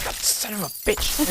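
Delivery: high, muffled cursing offstage; soft squeaky swearing to himself; muttering gnome professor. Acted. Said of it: "and he's like".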